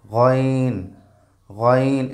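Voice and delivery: গইন from the far end of the throat, drawn out; throat-end sound. Shown as a demonstration. Teacher shows where the letter comes from, throat-end sound. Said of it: "কণ্ঠনালীর একদম শেষ থেকে".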